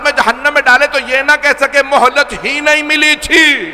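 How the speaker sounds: high, loud, impassioned sermon delivery; full-force preaching. Acted. Preacher thundering to the mourners about tyrants' respite. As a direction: preach it out to the whole gathering.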